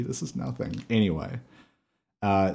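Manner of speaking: ordinary talk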